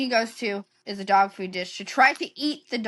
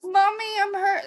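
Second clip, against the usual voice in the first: put-on whiny little girl; 9 semitones higher than usual. Daughter quoted complaining.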